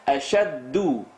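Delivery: Ashaddu with a North African dal at the gums; North African Arabic dialect. Retold or imitated. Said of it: "a North African dialect".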